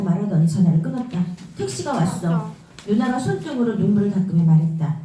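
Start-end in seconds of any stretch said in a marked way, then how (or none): none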